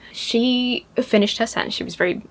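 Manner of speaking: ordinary delivery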